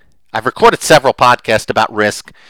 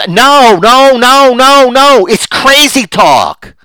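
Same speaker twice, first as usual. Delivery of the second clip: emphatic no; 11 semitones higher, 4 dB louder, much slower than usual. Retold or imitated. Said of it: "and I'm".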